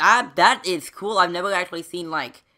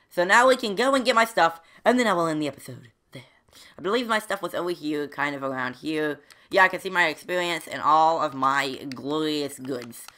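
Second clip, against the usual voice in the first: ordinary talk, nothing marked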